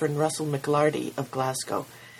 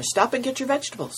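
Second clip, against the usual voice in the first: calling out; 9 semitones higher, faster than usual. Acted. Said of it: "cried the farmer".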